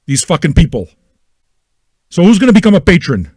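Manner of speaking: ordinary delivery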